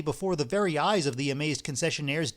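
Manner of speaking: usual speaking voice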